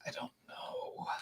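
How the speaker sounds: unvoiced whisper. Real, not acted. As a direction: breathe it without voice. Whisper after accent teasing.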